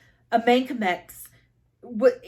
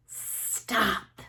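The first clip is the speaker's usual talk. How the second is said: breathless snake hiss; 4 dB quieter than usual. Acted. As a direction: hiss the word like serpents. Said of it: "they hissed".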